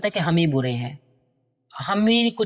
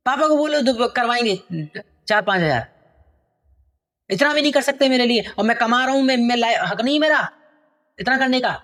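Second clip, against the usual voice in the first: angry son; 4 semitones higher than usual. Acted. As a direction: snap at her like a spoiled son. Angry son shouting at mother. (गुस्से में चिल्लाता बेटा)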